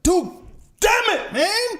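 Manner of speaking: high, frustrated outburst. Retold or imitated. Other driver upset after crash.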